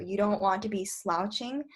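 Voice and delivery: usual speaking voice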